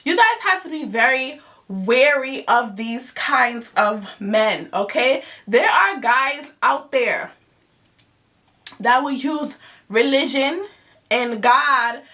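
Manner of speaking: normal talking voice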